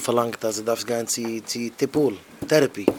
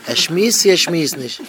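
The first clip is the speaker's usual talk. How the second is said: acted outburst; 5 semitones higher, 8 dB louder than usual. Acted outburst over talkers.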